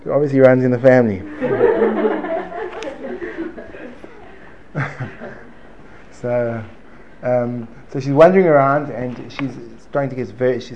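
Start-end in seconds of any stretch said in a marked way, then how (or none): 1.3-6.1 long high laugh; high-pitched, drawn-out laughter. Real, not acted. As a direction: burst into a long laugh at your own joke. Laughing after joking with audience.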